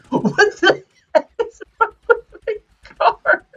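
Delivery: high-pitched nervous laughing. Real, not acted. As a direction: laugh through the panic. Car glitching, panicked laughing.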